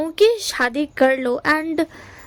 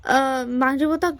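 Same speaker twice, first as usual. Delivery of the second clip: usual speaking voice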